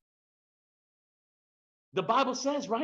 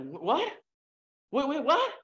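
rising in mock disbelief, higher at the end; baffled; 6 semitones higher than usual. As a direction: act stunned by what you just heard. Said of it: "And you're like".

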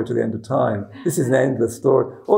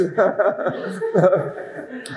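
soft, high laughing; real laughter trailing off; 6 semitones higher, 3 dB quieter than usual. Real, not acted. Laughing at memory.

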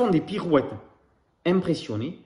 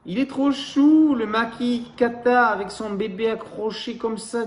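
much higher, slow, gushing; cooing child; 8 semitones higher, 5 dB louder, slower than usual. Acted. Baby koala coos over the lemur and its baby, in a high slow gushing voice. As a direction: gush over the cute baby. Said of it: "dit bébé koala".

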